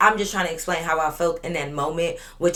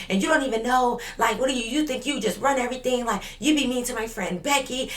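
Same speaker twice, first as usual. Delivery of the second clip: higher, mocking imitation of a girl running her mouth; mock Veronica voice; 6 semitones higher than usual. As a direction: lift the pitch and mimic her yapping at you. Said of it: "She just like".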